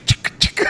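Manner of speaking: sharp mouth-made clicking noise; rhythmic mock tool sound; imitated scooper clicks. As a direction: click like an ice cream scooper.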